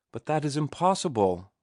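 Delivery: sudden high protest; cried out. A narrator's reading. Ruth pulls away from Martin's arms, crying out in alarm. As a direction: burst out in alarmed disbelief. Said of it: "she cried".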